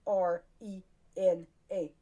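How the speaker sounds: slow letter-by-letter spelling; slow, deliberate spelling of her name. Shown as a demonstration. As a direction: spell each letter slowly and clearly. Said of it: "Spell it right".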